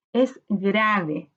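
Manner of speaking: ordinary delivery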